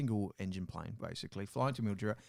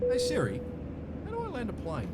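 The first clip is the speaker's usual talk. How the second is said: panicked pilot; 9 semitones higher than usual. Pretend. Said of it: "getting you to say the".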